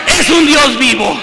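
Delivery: loud, emphatic cry; shouted proclamation. Acted. Preacher proclaims to the congregation, shouting. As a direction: shout it out to the church. Quiet then shouted repeat.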